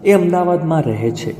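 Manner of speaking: ordinary talk, nothing marked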